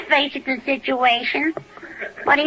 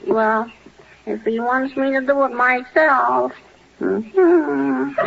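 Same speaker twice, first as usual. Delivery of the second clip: usual speaking voice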